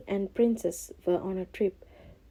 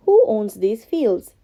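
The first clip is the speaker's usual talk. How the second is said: a louder kingly question; the king asks; 2 semitones higher, 9 dB louder than usual. Acted. King asks the workers who owns the fields, louder. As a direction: ask with royal interest. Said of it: "he asked".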